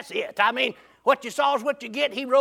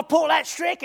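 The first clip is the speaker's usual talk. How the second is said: normal talking voice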